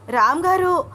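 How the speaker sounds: high, loud cry for help; wife's narrated pain cry, pitched well up. A narrator's reading. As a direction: call out loudly for your husband, hurting. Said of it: "అంటూ గట్టిగా అరిచింది అనన్య".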